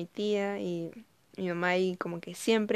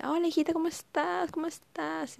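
saludo de madre, mucho más agudo; mamá dulce; 9 semitones higher than usual. Retold or imitated. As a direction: imita a la mamá encantada al teléfono. (mamá que saluda a su hija, más aguda y melosa)

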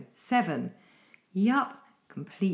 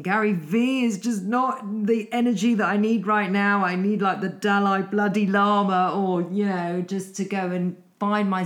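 loud, higher, fed-up rant to herself; louder, higher exasperated plea for zen; exasperated inner voice; 3 semitones higher, 6 dB louder than usual. Retold or imitated. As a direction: throw up your hands, desperate for calm. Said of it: "and I'm thinking".

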